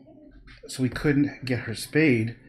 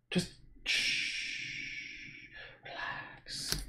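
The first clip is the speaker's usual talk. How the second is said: soft shushing whisper; hushing whisper; 13 dB quieter, much slower than usual. Real, not acted. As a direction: hush the dog with no voice. Hushing the restless dog.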